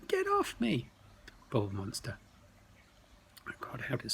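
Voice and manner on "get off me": even higher panicked squeal; frightened cry. Acted. Crinklebutt struggles in the goblin's grip, shrill desperate squeal.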